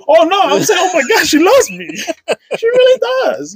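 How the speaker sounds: high, laughing mock delight; hammed-up joy, way up high; giddy. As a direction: squeal it like a lovestruck fool. Joking about realizing she loves him.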